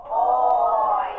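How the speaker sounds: long, loud "Oi"; stretched out. Shown as a demonstration. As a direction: draw "Oi" out loudly for the class. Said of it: "And diagonally".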